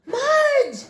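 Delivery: high, loud cry of the dog's name; boy yells at his wet dog; boy yelling. A narrator's reading. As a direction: shout at the dog, shocked. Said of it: "Henry yelled".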